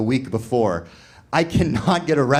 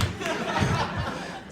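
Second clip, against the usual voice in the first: laugh; 8 dB quieter than usual. Real, not acted. Laughing at own story.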